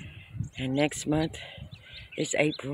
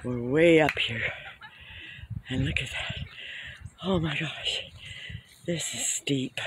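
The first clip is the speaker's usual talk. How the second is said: out of breath; 2 semitones lower, 6 dB quieter than usual. Real, not acted. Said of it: "No wonder I'm out of breath".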